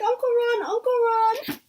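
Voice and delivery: put-on very high, squealing little-girl call; little niece squealing for her uncle at the station; excited little girl. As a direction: call out like a thrilled child running to her uncle. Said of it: "and Lily's like".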